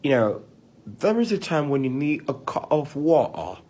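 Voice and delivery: put-on British accent, rounded vowels. Shown as a demonstration. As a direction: answer the request in a British accent. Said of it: "for a British accent".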